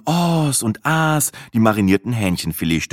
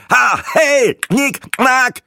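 much higher, loud mocking click sounds; tipsy man mocking; 5 dB louder, much slower than usual. A narrator's reading. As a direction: fire off the clicks like a drunk joker. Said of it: "Breitling feuerte mehrere stroboskopartige". (hoch und laut spottend)

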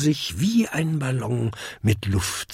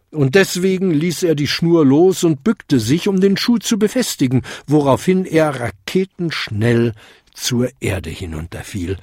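ordinary delivery, nothing marked

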